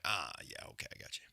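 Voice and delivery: low, hushed mock reply; quiet imitation. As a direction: murmur it like she finally gets it. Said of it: "watch that replay and be like".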